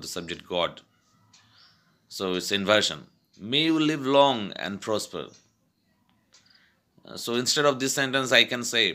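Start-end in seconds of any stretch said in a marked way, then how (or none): 3.5-5.2 "May you live long" raised high, then falling; emphatic wish. Shown as a demonstration. Teacher giving an inverted wish, "May you live long" high and emphatic. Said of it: "to emphasize the sentence".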